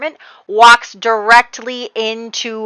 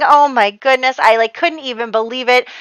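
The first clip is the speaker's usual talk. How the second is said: ordinary delivery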